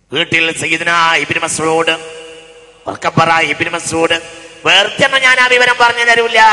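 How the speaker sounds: loud sermon call, jumping high on the last phrase; intoned preaching. Acted. Preacher prompting the congregation to repeat, intoned preaching. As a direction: project it, then climb to a held high note. Preaches loudly, rising at the end.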